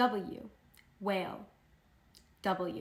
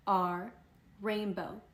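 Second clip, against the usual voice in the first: usual speaking voice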